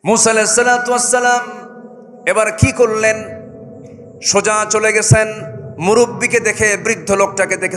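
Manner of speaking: high, loud sermon chant; full-force preaching. Acted. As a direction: preach it to the crowd.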